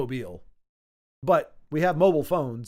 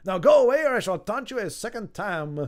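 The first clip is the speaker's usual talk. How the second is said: hammed-up mock French taunt; badly faked French accent, pushed louder and higher; Monty Python French accent; 4 semitones higher, 4 dB louder than usual. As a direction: put on an outrageous comic French accent. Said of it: "All I have is Monty Python".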